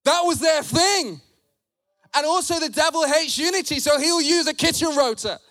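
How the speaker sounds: punchy, impassioned sermon delivery; forceful preaching. Real, not acted. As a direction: press each point hard on the congregation.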